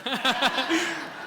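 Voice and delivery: loud, high burst of laughter. Real, not acted. Pastor laughing out loud at his own trauma joke, loud.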